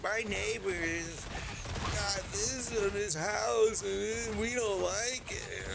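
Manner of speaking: high, slow tattletale voice; mocking a neighbor who reports him to the HOA, high and slow; mocking snitch neighbor. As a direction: mimic a whiny neighbor tattling. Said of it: "be a snitch and call and tell the H O A".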